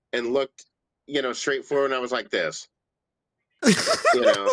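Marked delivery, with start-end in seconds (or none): 3.7-4.5 winded gasp. Retold or imitated. Winded face acted out.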